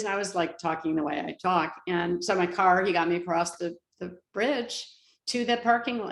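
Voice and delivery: ordinary talk, nothing marked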